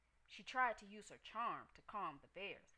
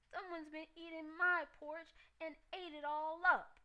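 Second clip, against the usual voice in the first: much higher, louder little bear wail; Baby Bear screaming; 10 semitones higher, 9 dB louder than usual. A narrator's reading. Baby Bear finds his porridge gone, high tearful scream. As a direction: scream it like an upset cub. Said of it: "screamed the Baby Bear".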